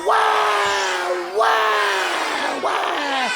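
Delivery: put-on very high, loud baby wail; screaming baby. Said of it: "It went".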